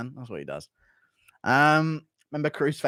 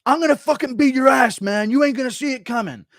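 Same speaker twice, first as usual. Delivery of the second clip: loud, higher macho trash talk; a fighter yelling threats across the cage, loud and higher; Dominic Cruz trash talk; 8 semitones higher, 6 dB louder than usual. Retold or imitated. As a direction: bark the threat at your opponent like a cocky fighter. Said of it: "but he would do something like".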